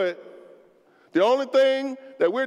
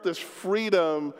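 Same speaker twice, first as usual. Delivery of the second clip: ordinary talk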